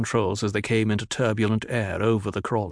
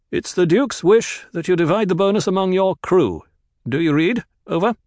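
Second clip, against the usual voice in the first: loud, higher relayed command; radio order; 9 semitones higher, 5 dB louder than usual. Acted. Kynes relaying the Duke's wish to the crew, loud and clear.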